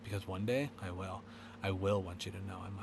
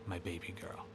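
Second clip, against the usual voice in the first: ordinary delivery, nothing marked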